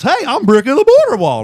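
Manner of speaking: loud, high-pitched put-on character voice; Bricky the Border Wall. Comic in wall costume greets a rally, high cartoonish character voice. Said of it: "And I talked like this".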